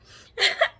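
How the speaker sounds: bursts out in a high loud laugh; real laugh. Real, not acted. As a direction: caught off guard, laugh out loud. Honey jar misbehaves on camera.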